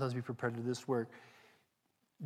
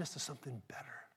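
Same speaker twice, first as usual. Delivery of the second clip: hushed, breathy whisper to the congregation; 12 dB quieter, faster than usual. Real, not acted. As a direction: lean in and whisper it. Sermon turns hushed.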